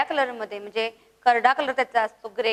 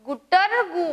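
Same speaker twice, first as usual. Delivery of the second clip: higher cooing with a rolled r; pigeon call; 4 semitones higher, slower than usual. Shown as a demonstration. Teacher showing pupils the pigeon's call, rolled coo. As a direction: imitate a pigeon's "gutar-gu" coo. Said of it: "कशा प्रकारे ओरडतो बरं कबूतर".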